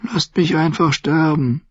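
hopeless plea. A narrator's reading. Sam sighs to his rescuers, faint and hopeless. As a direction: give up, barely wanting to go on.

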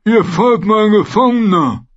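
Königsmörder sehr hoch, laut und langsam, feucht gedehnt; Königsmörder genüsslich sabbernd in die Länge ziehen; sabbernde Ziege. Acted. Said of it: "sabberte er". (Söldnerführer begrüßt den Gefangenen, hoch und sabbernd)

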